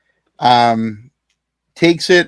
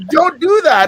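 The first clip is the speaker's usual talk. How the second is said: ironic high mock warning; 13 semitones higher than usual. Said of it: "And I'm nodding my head, yes".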